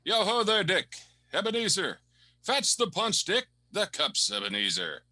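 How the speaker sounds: put-on high, loud, booming old merchant calling out; a hearty old master rallying his lads, full of good cheer; jolly Fezziwig. Old employer calling his apprentices to fetch punch, jolly and loud.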